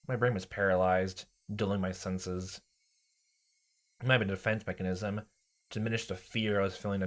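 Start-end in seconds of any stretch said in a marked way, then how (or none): none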